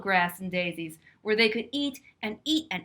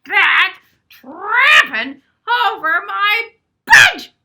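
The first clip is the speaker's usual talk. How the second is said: booming troll roar, much louder and slow; 12 semitones higher, 16 dB louder, slower than usual. Acted. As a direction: roar it out like an angry troll under the bridge. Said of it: "roared the troll".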